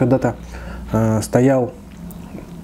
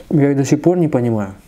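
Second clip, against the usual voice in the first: normal talking voice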